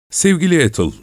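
mild high-pitched exclamation; crying out. A narrator's reading. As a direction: cry out lightly, well up in pitch. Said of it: "diye bağırdı Marion".

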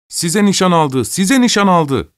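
high, drawn-out shouted chant; children shouting. A narrator's reading. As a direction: call it out like a gang of kids. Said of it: "hep bir ağızdan bağırdılar".

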